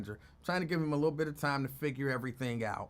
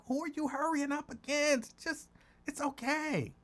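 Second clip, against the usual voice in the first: high-pitched teasing; 10 semitones higher, 3 dB quieter than usual. Retold or imitated. Host retelling her reply to Lincoln hurrying, high-pitched teasing.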